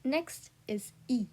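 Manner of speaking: ordinary delivery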